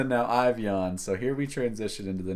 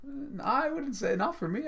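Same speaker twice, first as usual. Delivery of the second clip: surprised. Real, not acted. Surprised denial.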